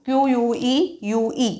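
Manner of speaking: letters spelled out slowly and softly; spelling out slowly. Shown as a demonstration. Teacher spells the word for the class, slow and soft. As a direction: spell each letter apart. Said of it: "Q म्हणजे हा Q".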